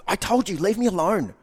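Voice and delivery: high, loud acted snap; angry outburst. Woman testing her man, acted angry yell. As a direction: yell it as an upset partner pushing away.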